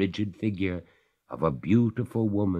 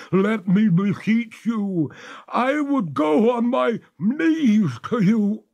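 high, slow, louder freezing ghost; 7 dB louder, slower than usual. Acted. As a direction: complain through the cold, slow and high. Ghost's final plea.

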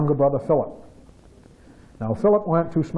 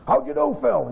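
much higher, eager dad asking; the father greets his son off the bus, much higher and eager; 10 semitones higher than usual. Retold or imitated. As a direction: pipe up as the hopeful father.

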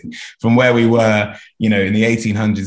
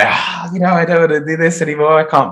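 high-pitched pretend despair; hammed-up tearful whine, no real sobs; mock crying; 7 semitones higher than usual. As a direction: act out someone breaking down, overwhelmed. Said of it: "Normally you're crying".

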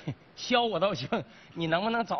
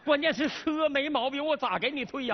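raised, higher protesting voice; yelling back; 7 semitones higher than usual. Acted. Salesman refusing a car return, yelling back. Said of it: "你给我喊啥".

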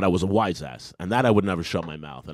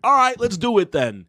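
high, hammed-up acceptance; accepting the fight in a high mock voice; 12 semitones higher, 4 dB louder than usual. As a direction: voice pushed up for a cocky agreement.

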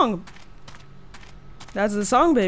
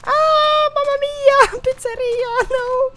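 1 mock Italian; 13 semitones higher than usual. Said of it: "mock Italian exclamation".

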